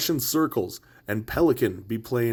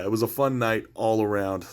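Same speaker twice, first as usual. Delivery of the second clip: usual speaking voice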